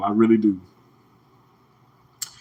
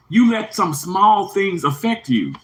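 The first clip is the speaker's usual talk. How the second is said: ordinary delivery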